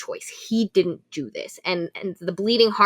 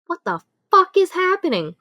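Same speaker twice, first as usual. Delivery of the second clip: high, loud cry of alarm; alarmed outburst; 10 semitones higher, 5 dB louder than usual. Retold or imitated. Elle reacting as Orion fights through her shield, high and loud. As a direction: shout it as he forces through the shield.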